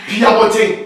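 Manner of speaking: high, very loud yell; explosive, high-pitched command to leave; shouted order out. Acted. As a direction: shout her out of the room. Pastor ordering woman outside.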